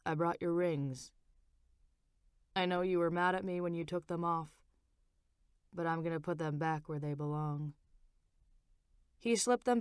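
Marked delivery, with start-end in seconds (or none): 0.1-7.7 low, soft husband voice; husband instructing the nurse, low and soft; Frank's voice. A narrator's reading. Lower, quieter Frank voice. Frank putting rings back.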